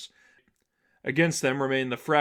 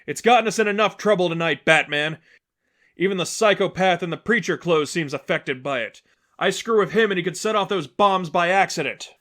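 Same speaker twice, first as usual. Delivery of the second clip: a raised, quick, insistent heroine; urgent refusal; 6 semitones higher, 6 dB louder than usual. Acted. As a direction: rattle it off fast and firm, pitch up.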